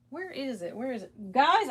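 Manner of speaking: normal talking voice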